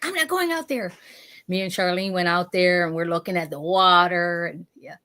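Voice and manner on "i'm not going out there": higher, lightly scared refusal; hint of a frightened cousin refusing; mock scared refusal. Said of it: "She's like".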